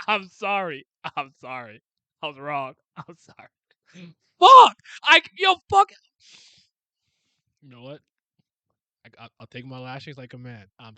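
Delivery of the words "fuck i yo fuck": loud, high yell of frustration; sudden sharp outburst; real shout. Real, not acted. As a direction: explode at the page. Yells at the fight reveal.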